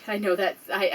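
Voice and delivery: ordinary talk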